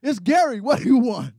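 brusque answering the phone as Behold. Pretend. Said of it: "do you ever answer your phone".

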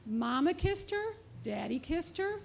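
plain reading voice

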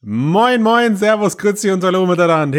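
high, fast teasing hello; overdone chirpy greeting to annoy; exaggerated sing-song greeting. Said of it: "Ich mach's nur, weil's dich quält".

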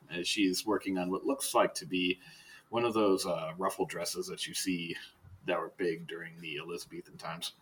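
normal talking voice

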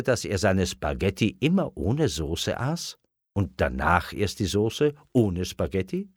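ordinary delivery, nothing marked

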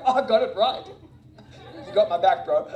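slap hands and cheer like a buddy; pumped buddy voice with a laugh. Retold or imitated. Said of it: "I call it the high five Jesus".

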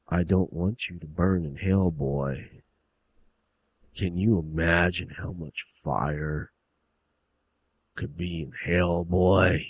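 low, slow, quiet threat; softly sinister father, pitch dropped; menacing hillbilly dad. Acted. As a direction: drawl it deep and deliberate, like a hellfire warning. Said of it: "this is the dad".

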